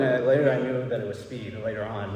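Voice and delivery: ordinary talk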